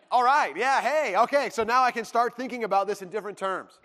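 eager, loud and high burst of relief; like the girl just became available, thrilled; sudden delighted rush; excited. Pretend. Imagined relief, camp girl now free.

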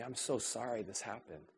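removing a speck from a friend's eye, soft and caring; gentle comfort. Pretend. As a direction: soothe a hurting friend gently. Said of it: "gently ... you say".

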